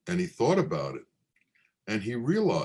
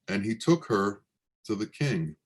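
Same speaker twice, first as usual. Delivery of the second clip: ordinary delivery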